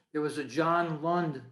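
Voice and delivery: normal talking voice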